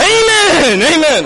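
very high, loud congregation shout; hammed-up church cry; fervent amen. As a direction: holler back to the preacher. Acts congregation shouting back.

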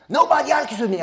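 hammed-up bossy elder; scolding elder. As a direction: brush him off impatiently. Elder boasting.

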